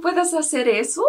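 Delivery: ordinary talk, nothing marked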